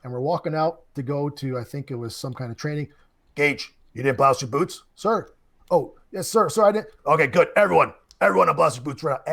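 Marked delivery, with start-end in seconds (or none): 3.3-4.8 stern drill instructor voice. Retold or imitated. Drill instructor catches unbloused boots. 4.9-6.9 loud, high recruit sounding off; barked sir yes sir. Retold or imitated. Recruit answering drill instructor. 7.1-9.3 loud, fast barked order; drill instructor shout. Retold or imitated. Drill instructor punishes whole platoon.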